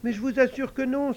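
high, loud protest from the little devil; plead, crying out; little devil protesting loudly. Acted. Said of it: "s'écria le petit diable".

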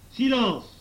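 loud, high command for silence; repeated order. A narrator's reading. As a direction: cut the chatter off with a shout. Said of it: "répéta le commissaire".

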